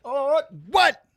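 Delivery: high loud "oh, what"; mock surprise. Real, not acted. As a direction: act startled, loud and high. Reacting to own joke.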